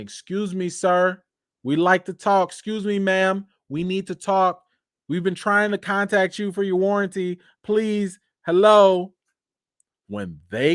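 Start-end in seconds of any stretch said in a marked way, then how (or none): 0.0-9.1 a lightly voiced, higher, insistent warranty caller; pushy caller. L A Times knocking at the door, a mock pushy caller. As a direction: pester them like a scam call at the door. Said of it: "is knocking at your door and saying".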